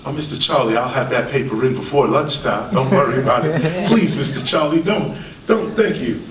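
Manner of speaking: low, placating mock plea to the boss; meek employee. Worker appeasing Mister Charlie over a late paper, mock meek. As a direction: play the worker backing down, voice dropped low.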